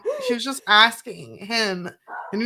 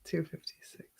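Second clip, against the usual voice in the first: breathed almost silently; soft whisper to yourself; 2 semitones lower, 11 dB quieter than usual. Real, not acted. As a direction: read the number under your breath. Reading word count under breath.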